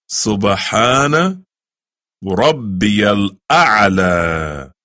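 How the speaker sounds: slow, drawn-out Arabic recitation. Shown as a demonstration. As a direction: stretch every syllable of the prayer. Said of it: "you say".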